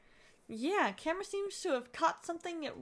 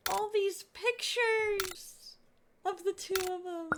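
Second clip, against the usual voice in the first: drawn-out gush; 7 semitones higher than usual. Real, not acted. Gushing over photos.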